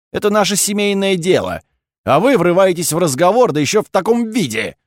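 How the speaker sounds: high, forceful outburst of indignation; indignant rebuke. A narrator's reading. Duke telling off the count for intruding, indignant.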